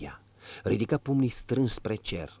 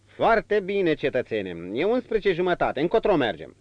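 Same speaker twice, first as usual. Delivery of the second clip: 1 brisk coachman; 9 semitones higher, 5 dB louder than usual. A narrator's reading. Coachman voiced.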